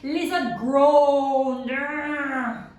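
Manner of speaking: loud slow groaning urgh; annoyed lizard groan; frustrated groan. Acted.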